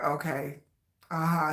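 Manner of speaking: low, quiet and bored. Retold or imitated. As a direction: say it with zero emotion. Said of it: "He'd just be so deadpan with it".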